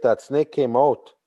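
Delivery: ordinary delivery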